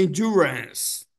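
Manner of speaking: "Endurance." slow, stress on DU; "Endurance." slow, en-DU-rance; stress on second syllable. Shown as a demonstration. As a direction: lean on the middle of "Endurance.". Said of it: "acento na segunda sílaba".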